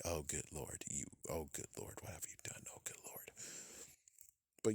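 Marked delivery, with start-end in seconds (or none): 0.0-3.3 fading into a horrified whisper; softer and softer, appalled; dismayed whisper. Retold or imitated. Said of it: "He's like".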